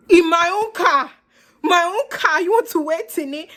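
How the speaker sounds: high, indignant disbelief; incredulous outrage. Retold or imitated. Storyteller reacting to date waiting in her car, outraged. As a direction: throw it out high, scandalized.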